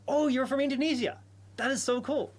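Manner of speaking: light up at the news; bright burst of excitement to a viewer; delighted surprise. Real, not acted. Viewer says she's from Indonesia.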